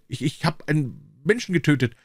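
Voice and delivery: a high, faltering confession; halting, stammering. A narrator's reading. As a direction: stumble over the words in shock. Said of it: "brachte sie stockend hervor".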